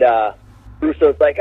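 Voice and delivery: ordinary delivery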